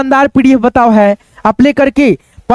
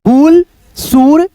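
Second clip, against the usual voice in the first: sounding out; 4 semitones higher, much slower than usual. Shown as a demonstration. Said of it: "ये छोटे छोटे शब्द है".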